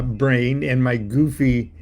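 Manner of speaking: ordinary talk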